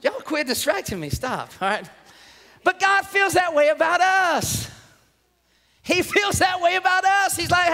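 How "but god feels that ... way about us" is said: higher, hollered declaration; emphatic preaching. Real, not acted. Preacher telling congregation how God feels, emphatic. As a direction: drive the point home forcefully. Preacher drives home main point.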